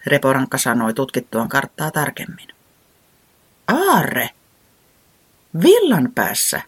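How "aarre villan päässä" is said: higher, baffled questions; puzzled surprise. A narrator's reading. Owl baffled that treasure is at its home end, higher surprised questions. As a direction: sound caught off guard. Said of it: "Pöllis hölmistyi".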